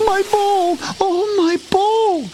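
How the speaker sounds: high, drawn-out wail of a princess; dismayed cry. A narrator's reading. Princess who has lost her golden ball, wailing in dismay. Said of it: "cried the princess in dismay".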